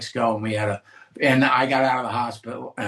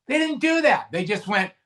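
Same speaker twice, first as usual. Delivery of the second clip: ordinary delivery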